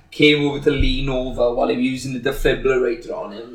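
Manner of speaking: ordinary talk, nothing marked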